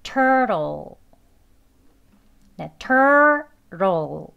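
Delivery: "turtle" stretched, t turned into a soft r-like flap; soft American flap. Shown as a demonstration. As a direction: pull the tongue back for a long "tur-rl". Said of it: "리을 소리 나는 발음으로 하겠습니다".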